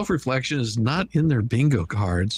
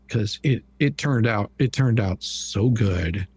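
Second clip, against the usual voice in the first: ordinary talk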